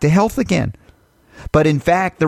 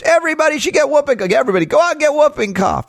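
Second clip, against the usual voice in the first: high, fast, silly parody voice; much higher and fast mock cheer; mock endorsement; 12 semitones higher, faster than usual. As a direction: rattle it off like a ridiculous slogan. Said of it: "I'm not here to endorse".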